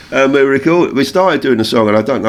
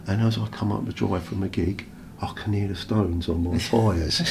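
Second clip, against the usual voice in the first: dreamy, soft; 9 dB quieter than usual. Retold or imitated. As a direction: paint the dream gently. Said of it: "he done it so beautifully".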